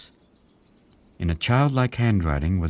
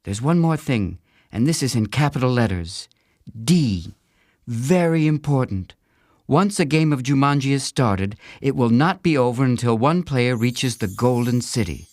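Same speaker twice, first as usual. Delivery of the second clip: put-on a lightly raised girl's voice reading rules carefully; girl reading instructions; 6 semitones higher than usual. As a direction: read the rules out like a bossy big sister. Judy reads the important rule.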